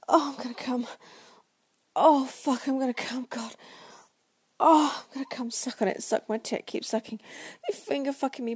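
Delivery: loud, breathless pleading; urgent climax cry. Acted. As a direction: cry out, overwhelmed and building. Acted climax, urgent cries.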